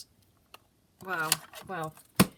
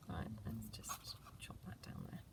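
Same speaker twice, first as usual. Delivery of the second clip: soft lower whisper; 9 semitones lower, 14 dB quieter than usual. Real, not acted. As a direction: whisper to herself. Whispers while cutting.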